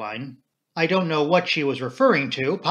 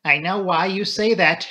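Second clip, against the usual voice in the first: higher, louder protest; raised-voice objection; cried out; 5 semitones higher, 5 dB louder than usual. A narrator's reading. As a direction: cry it out in mild indignation. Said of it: "she cried".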